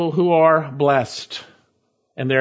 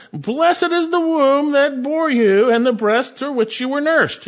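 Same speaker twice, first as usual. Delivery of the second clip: a woman calling out from the crowd, pitched higher; mildly raised, higher imitated call; 10 semitones higher, 4 dB louder than usual. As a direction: lift the voice over a crowd. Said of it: "raised her voice and said".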